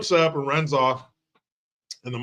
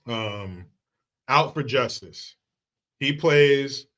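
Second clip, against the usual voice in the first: usual speaking voice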